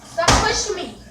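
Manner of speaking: loud protest; angry shout. Real, not acted. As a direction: shout them off you. Pushed by unseen prankster.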